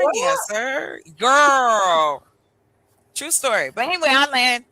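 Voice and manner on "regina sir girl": mock sassy call-out; a hint of a sassy girl. Acted quote.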